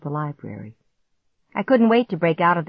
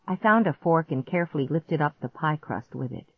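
usual speaking voice

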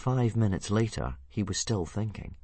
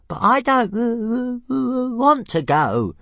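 high stuttering little dragon, matter-of-fact; squeaky stutter, slow on want; Toothless reasoning; 10 dB louder, slower than usual. Acted. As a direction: stammer a calm objection. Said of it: "pointed out, equally reasonably".

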